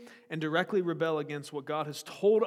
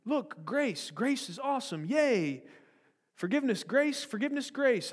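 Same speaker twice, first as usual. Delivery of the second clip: mock cheer; 7 semitones higher than usual. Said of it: "those Christians were saying".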